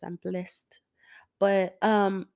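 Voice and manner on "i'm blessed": whisper. Real, not acted. Whispered aside about pastors.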